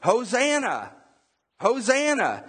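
high-pitched cheering cry; mock crowd yell. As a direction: imitate a crowd hailing someone. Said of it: "they're probably yelling".

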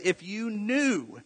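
slow, solemn words of Jesus; preacher voices Jesus' reply to the woman, slow and solemn; Jesus' slow answer. Retold or imitated. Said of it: "Jesus answers and he says".

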